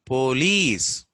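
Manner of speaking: police with a long full "po"; drawn-out round o in "police"; Indian pronunciation. Shown as a demonstration. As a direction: say "police" as "POH-leece", loud. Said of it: "They say".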